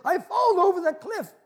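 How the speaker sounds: high, loud plea for help; panicked tourist. Acted. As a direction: plead up high, calling up the cliff. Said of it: "And the tourist says".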